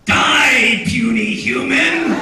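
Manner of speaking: huge, high dramatic shout; full-force shout, stretching the last words; villainous battle cry. Acted. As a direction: roar it slowly as a hammed-up death threat. Performs favorite line as villain's cry.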